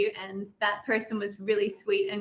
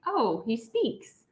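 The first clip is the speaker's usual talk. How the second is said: hammed-up surprise, laughing through it; mock amazement; 7 semitones higher, slower than usual. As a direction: act amazed, teasing, slow and rising. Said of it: "And I'm like".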